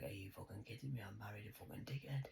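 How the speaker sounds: whispered, voice dropped low; exasperated whisper. Retold or imitated. Bride at the wedding speeches, whispering disbelief. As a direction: mutter it under your breath in disbelief. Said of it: "I'm like".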